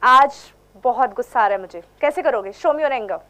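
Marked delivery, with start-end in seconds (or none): 0.0-1.8 low, stern angry line with a hard first word; low angry burst on आज; angry demo. Shown as a demonstration. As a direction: show real anger, not smiling. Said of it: "गुस्से का मतलब".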